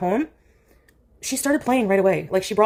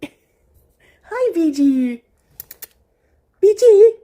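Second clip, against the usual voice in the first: squeaky pet greeting; 9 semitones higher, 8 dB louder, much slower than usual. Real, not acted. Greeting cat in baby voice.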